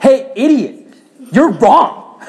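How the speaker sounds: high, loud mock scolding; hammed-up rude telling-off; harsh correction. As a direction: blurt it out as an exaggerated insult. Acted harsh way of correcting someone.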